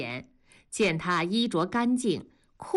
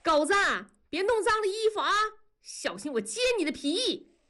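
lilting call; 13 semitones higher, faster than usual. A narrator's reading. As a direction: sing out a warning to your son. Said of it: "悠扬的声音就飘了过去". (悠扬高亢地喊孩子)

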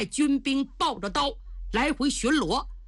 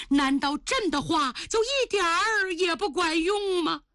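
plaintive protest, voice pitched up and held high; exasperated emperor; 5 semitones higher than usual. Acted. Emperor pleading with his consort to heed him, exasperated. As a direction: protest like a ruler who feels ignored.

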